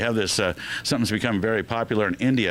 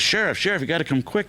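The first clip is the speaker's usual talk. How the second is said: higher-pitched, mildly urgent call for help; urgent farmer; 7 semitones higher than usual. Acted. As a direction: lightly act out a startled farmer. Startled farmer runs to sheriff.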